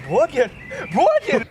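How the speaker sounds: high, loud disbelieving question; incredulous. Real, not acted. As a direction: squeak out the disbelief. Incredulous at odd name.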